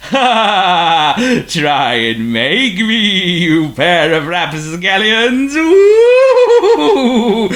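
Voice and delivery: slow, loud, booming ghostly voice; eerie ghost voice. A narrator's reading. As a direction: boom like a spooky conscience. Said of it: "the spooky voice came again".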